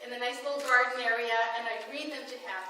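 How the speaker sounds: normal talking voice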